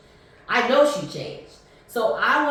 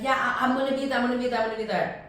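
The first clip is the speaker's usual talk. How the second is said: rushed promise; 4 semitones higher, much faster than usual. Retold or imitated. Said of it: "She's like".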